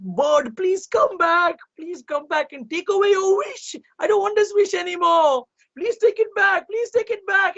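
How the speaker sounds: crying king pleading with the bird; crying plea. A narrator's reading. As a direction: beg the bird through tears. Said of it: "as he cried and cried".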